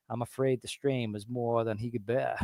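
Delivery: hushed movie-quote voice; imitated gunslinger line. Co-host quotes Doc's remark over the body, hushed imitation. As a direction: drop the volume right down, quoting in character. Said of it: "that's when he says".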